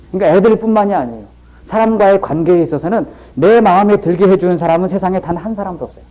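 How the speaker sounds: ordinary delivery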